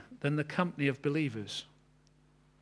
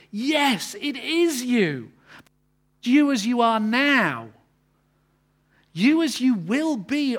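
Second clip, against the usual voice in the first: loud, high, slow proclamation; full-force preaching; 9 semitones higher, 8 dB louder, slower than usual. Acted. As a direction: ring it out as a triumphant declaration.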